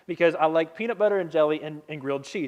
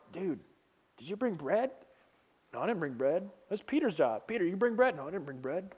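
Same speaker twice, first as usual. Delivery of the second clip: hushed side chatter; 8 dB quieter than usual. Retold or imitated. Disciples whispering among themselves about bread.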